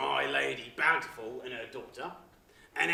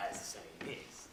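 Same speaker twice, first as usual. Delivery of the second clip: whispered aside; 9 semitones lower, 14 dB quieter than usual. Acted. Servant murmuring his catchphrase to the robbers. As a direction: whisper the catchphrase under breath.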